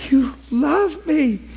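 high, slow, crying to God; tearful cry. Retold or imitated. Preacher reliving his bedroom breakthrough, tearful. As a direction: let the voice break on it. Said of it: "and I'm going, you know, like".

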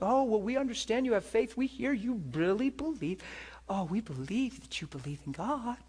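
high, soft, patronizing sing-song; talking to a child. Retold or imitated. Doctors humouring a patient they think crazy, child-directed sing-song. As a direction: humour them as if to a little kid. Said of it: "they start talking like a child again".

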